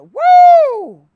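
high, loud joyful whoop; excited whoop. Real, not acted. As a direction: shout for joy at the point. Preacher celebrating his point.

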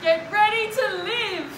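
high, loud proclamation; rousing exclamation. A narrator's reading. Gardener to Basil at a new beginning, rousing exclamation. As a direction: herald it like a big story moment.

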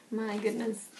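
ordinary delivery

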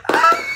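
high surprised yelp; startled laughing cry. Real, not acted. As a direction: yelp in dismay. Drops pen.

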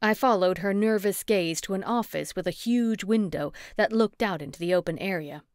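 ordinary delivery, nothing marked

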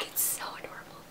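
very soft, voiceless aside of delight; hushed gushing. Real, not acted. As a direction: gush in a near whisper. Gushing over a cute notebook.

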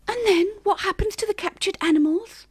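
high, small mouse voice; squeaking mouse. A narrator's reading. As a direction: squeak the question like a little mouse. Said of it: "the mouse squeaked".

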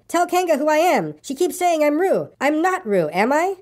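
high-pitched, pleading protest; crying out. A narrator's reading. Piglet begging Christopher Robin to identify him, crying out. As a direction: appeal for backup, pitch held high throughout. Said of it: "cried Piglet".

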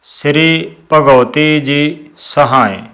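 ਸ੍ਰੀ said as "sri", no dot under the letter; slow and careful, ਸ੍ਰੀ without the sh sound; ਸ੍ਰੀ ਭਗਉਤੀ ਜੀ ਸਹਾਏ, ਸ੍ਰੀ ਸਾਫ਼ ਬੋਲੋ; slow recitation. Shown as a demonstration. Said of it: "ਸ੍ਰੀ ਨਹੀਂ ਕਹਿਣਾ".

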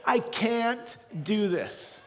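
high, strained plea to stop; a man at the end of his rope; desperate outburst. Retold or imitated. Pastor reliving his breakdown, desperate pleading.

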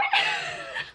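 sudden high-pitched giggle; high laugh. Real, not acted. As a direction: laugh out in shock. Shocked by the fanfic plot.

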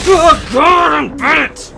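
high, loud startled yell; scared shout. Real, not acted. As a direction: yelp from a jump scare. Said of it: "that definitely got me".

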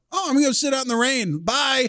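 high and fast, breezy fake-polite brush-off; mock hasty exit. Hikers fleeing the sick guy, high breezy fast goodbye. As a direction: chirp it out while backing away quickly. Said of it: "everybody was just like".

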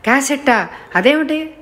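high, alarmed double question; flustered. A narrator's reading. Mukundam startled to hear of the cassette, flustered. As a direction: caught off guard, voice jumping up in panic. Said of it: "కంగారుగా అన్నాడు".

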